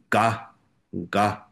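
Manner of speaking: ga voiced heavily, not ka; ga repeated slowly as a voiced sound; heavier ga. Shown as a demonstration. Teacher showing the changed letter, heavier ga. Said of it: "比较重一点".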